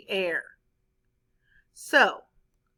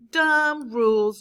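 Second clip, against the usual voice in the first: scornful; 7 dB louder, slower than usual. Acted. Pete scorning the class promise.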